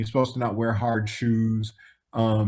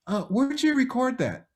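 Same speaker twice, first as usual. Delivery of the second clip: Michael impression; 10 semitones higher than usual. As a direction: lift the voice into a gentle high Michael. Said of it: "he goes".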